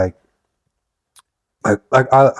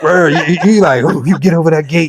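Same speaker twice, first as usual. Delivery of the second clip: breathless retelling; 8 semitones higher, 3 dB louder than usual. Retold or imitated. Acting out fleeing a dog.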